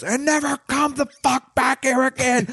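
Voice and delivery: high mock yell, pitched well up; retold scream of rage at a creep, pitched high. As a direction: yell him off like an angry man, half-acted. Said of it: "just starts screaming at him".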